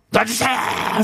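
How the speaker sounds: high yelled plea, let me go; retold yell. Student yelling at the gym teacher to let him go, a high yelled plea. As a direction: yell it at the teacher holding you. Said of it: "소리를 지른 거예요".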